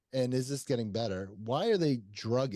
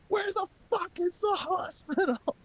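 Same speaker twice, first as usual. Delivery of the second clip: much higher, strained desperate voice; frantic repeated demand. Retold or imitated. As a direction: beg for the hospital, at the end of your rope. Over and over and over again.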